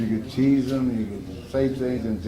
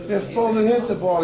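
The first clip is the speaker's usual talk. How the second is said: high, louder indignant comeback; acted protest; 10 semitones higher, 6 dB louder than usual. Pitcher defending himself to coach, acted protest. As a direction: argue back in mock exasperation.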